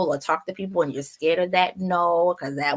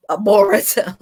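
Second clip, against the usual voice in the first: laughing through the word; 4 semitones higher, 9 dB louder than usual. Real, not acted. Stumbling over a hard name.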